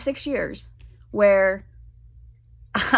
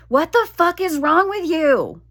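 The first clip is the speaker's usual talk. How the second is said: higher, louder exasperated scolding; mock yelling; 8 semitones higher, 4 dB louder than usual. Yelling at her own body while building a bookshelf, mock scolding. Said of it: "I was yelling at my vagina".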